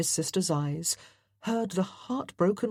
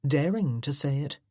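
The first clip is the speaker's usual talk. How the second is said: plain reading voice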